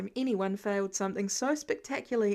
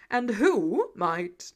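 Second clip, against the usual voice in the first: stately booming question; slow grand Thor; 2 semitones lower, 5 dB louder, much slower than usual. A narrator's reading. Thor addressing the class, slow and grand. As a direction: ask it like royalty.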